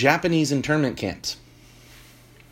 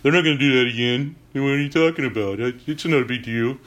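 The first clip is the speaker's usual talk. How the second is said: dismissive skeptic. Retold or imitated. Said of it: "You're like".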